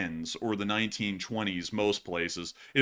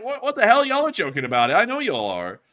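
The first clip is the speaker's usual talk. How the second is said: loud, high-pitched disbelief; mock incredulous protest; 7 semitones higher, 7 dB louder than usual. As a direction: push back in exaggerated exasperation. Mock incredulous reply to defensive locals.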